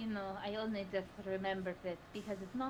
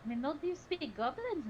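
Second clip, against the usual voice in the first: ordinary talk, nothing marked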